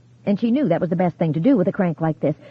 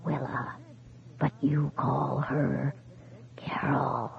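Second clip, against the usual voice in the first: softer with each word into a whisper; fading syllable by syllable; 4 semitones lower, 11 dB quieter, much slower than usual. A narrator's reading. Ghost fades out on the phone, softer each syllable. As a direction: let it die away word by word. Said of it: "his voice fading syllable by syllable".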